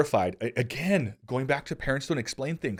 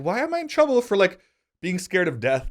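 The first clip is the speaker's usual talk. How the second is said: high, loud retold protest; indignant; 9 semitones higher, 6 dB louder than usual. Suspended kid protests his unfair punishment, indignant.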